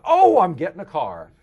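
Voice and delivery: loud, high, drawn-out cry of delight; excited outburst. Retold or imitated. Teenager realising what he will be paid, jubilant shout. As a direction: burst out as the thrilled teenager. Teen realises he is suddenly rich.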